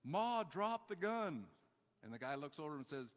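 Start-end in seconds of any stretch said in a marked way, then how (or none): 0.0-1.5 loud, high, drawn-out command; imitated urgent order. Preacher dad orders Ma to disarm, imitated loud high call. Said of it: "He's like".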